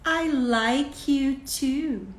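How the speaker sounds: slow, drawn-out tender reply; affectionate parent. Acted. Parent answering the child's affection, slow and tender. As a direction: reassure your child, stretching each word. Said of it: "Então, você fala".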